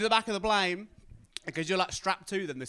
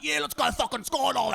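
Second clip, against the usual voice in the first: high, louder put-on shout; hyped instructor; 7 semitones higher, 5 dB louder than usual. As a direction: pump him up like a wild instructor. Blasé instructor hypes up jump.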